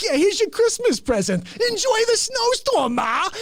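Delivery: mock cruel son. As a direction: sneer as you shut the door. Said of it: "He's like".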